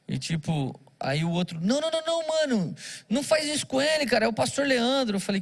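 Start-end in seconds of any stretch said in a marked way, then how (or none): 1.6-5.2 high, rushed street-guy pleading; pitched-up voice of a young thug calling off a robbery; urgent robber's friend. Retold or imitated. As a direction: step in fast to stop your buddy. Robber's friend stops the mugging.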